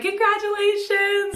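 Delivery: high, slow, sing-song congratulations; a cheer from the crowd; gushing congratulations. Retold or imitated. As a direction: stretch it out like a cheering friend. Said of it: "And they're like".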